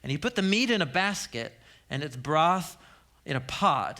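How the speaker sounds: ordinary talk, nothing marked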